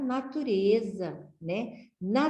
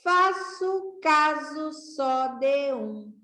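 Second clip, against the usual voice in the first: slow reading aloud; 3 semitones higher, much slower than usual. Shown as a demonstration. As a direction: read the rhyme out slowly, syllable by syllable. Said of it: "Vamos ler?".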